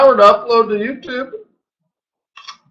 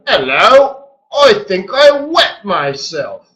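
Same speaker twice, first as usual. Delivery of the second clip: bad London accent; 3 semitones higher, slower than usual. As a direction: fake London accent, drawn out and unconvincing. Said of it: "That didn't seem like a London accent".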